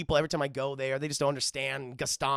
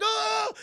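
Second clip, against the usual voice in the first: high, louder mock yelp; startled cry; 5 dB louder than usual. Man startled by a talking cup, yelping. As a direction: cry out in exaggerated surprise.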